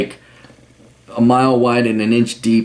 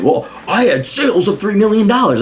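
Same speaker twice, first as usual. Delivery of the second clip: higher-pitched mocking brag; boastful dude bro; 8 semitones higher than usual. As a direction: puff it up like a self-important banker. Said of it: "some aged dude bro like this".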